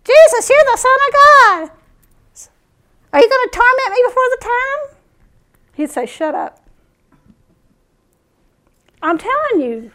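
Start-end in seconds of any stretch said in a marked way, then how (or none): 0.0-4.8 high, loud crying out; retold demon yell. Demon-possessed man yelling at Jesus, high mock cry. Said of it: "they'd yell out". 6.0-6.6 retold quiet command. Jesus silencing demons, retold.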